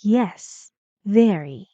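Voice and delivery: "very" starting strong, then falling; falling intonation. Shown as a demonstration. Teacher repeats for pupils, falling end on "very". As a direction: close "Yes, very" with a downward drop. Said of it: "falling intonation".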